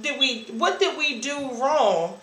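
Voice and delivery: retold hurt, rising and loud; anguished outcry. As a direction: cry it out like you were abandoned. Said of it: "And I'm like".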